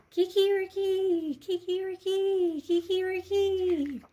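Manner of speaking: high, loud, slow rooster crows. Acted. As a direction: crow out proudly and long. Rooster's final song.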